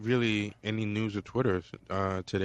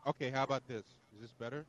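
far from mic; 10 dB quieter, faster than usual. Real, not acted. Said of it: "you sound like you're far away".

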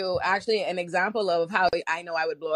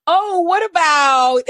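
mock; 9 semitones higher, 8 dB louder, much slower than usual. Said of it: "She goes, she goes".

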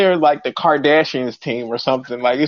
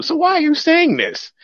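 much higher, exasperated question; incredulous; 13 semitones higher than usual. Real, not acted. Ranting at pundits who won't pick against Burrow, incredulous. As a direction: demand an answer, worked up.

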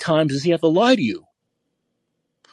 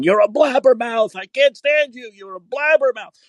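high-pitched mock scream of blabbermouth; retold screaming; 10 semitones higher than usual. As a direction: shriek it up high like Ralph losing it. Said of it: "He's screaming in the microphone".

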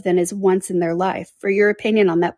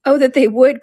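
higher and louder, bursting with delight; delighted cry; 5 semitones higher, 6 dB louder than usual. Acted. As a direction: cry out, glowing at the dream of a fortune. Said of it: "her eyes sparkling with animation".

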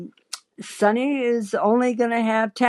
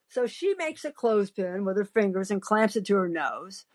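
plain reading voice

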